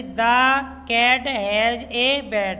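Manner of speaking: very slow, word-by-word reading. Shown as a demonstration. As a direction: read it out slowly for the children. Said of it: "reads sentence slowly to class". (बच्चों को धीरे-धीरे पढ़कर सुनाना)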